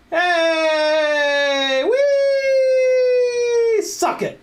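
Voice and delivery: high, loud victory cheer; triumphant whoop. Real, not acted. Alignment succeeds.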